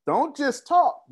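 ordinary talk, nothing marked

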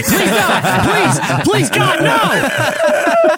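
rising squeal of begging; shrill mock begging. Begging, voice shoots up.

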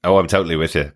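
normal talking voice

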